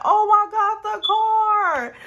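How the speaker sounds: high, loud panicked wail; panicking. Retold or imitated. Storyteller replays seeing sugar daddy's wrecked car, panicked wail. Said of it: "I'm just panicking".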